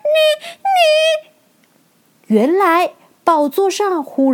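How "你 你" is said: 又高又尖的小女孩惊叫; 吓得尖声结巴起来; 惊恐尖叫. A narrator's reading. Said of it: "不禁尖叫起来". (惊恐地尖叫)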